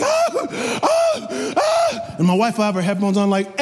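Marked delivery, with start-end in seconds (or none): 0.0-2.2 put-on very high wailing baby cry; mock baby crying in a very high voice; imitated baby cry. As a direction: wail like a fussing infant. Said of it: "The baby's crying".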